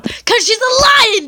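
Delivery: high, slow, gushing 'she's a lion'; squealing at the screen in delight; excited squeal. Real, not acted. Reader gushing to viewers about Yang, high and slow. Fangirl reaction to the story.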